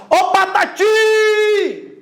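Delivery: high shout with a very long "ti"; calling out. Shown as a demonstration. Teacher calling old friend Patati, calling out. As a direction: hail a friend far off, stretching "Patati" at the end. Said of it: "Você vai chamar".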